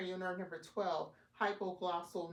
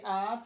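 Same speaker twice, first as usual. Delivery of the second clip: loud open ahh sound; saying ahh; 2 semitones higher, 9 dB louder than usual. Shown as a demonstration. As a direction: show the patient a long ahh. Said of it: "All right, say".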